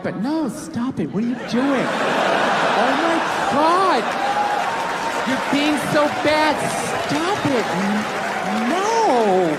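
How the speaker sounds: giggly, playful fake protest; half-laughing mock girl saying no; mock coy woman.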